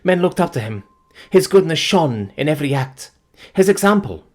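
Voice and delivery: high, loud fervent praise; cling to his memory; fervent grief. Acted. Fiancée praising her dead love, fervent and grieving.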